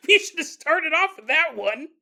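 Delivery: loud, high incredulous outburst; exasperated disbelief. Acted. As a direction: blurt it out, amazed and loud. Exasperated listener reacts.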